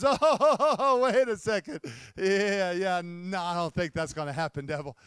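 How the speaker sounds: laughing imp. Pretend. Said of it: "the imp looks at him and says".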